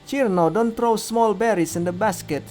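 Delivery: high, loud telling-off; scolding. A narrator's reading. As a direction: scold a companion across the berry patch. Scolding during berry squabble.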